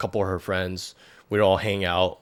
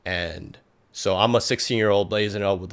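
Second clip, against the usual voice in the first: normal talking voice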